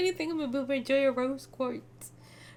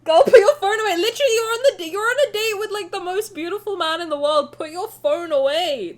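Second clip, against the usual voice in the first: high, loud playful scold; mock scolding; 6 semitones higher, 9 dB louder than usual. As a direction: tell him off, half-joking. Scolding the player jokingly.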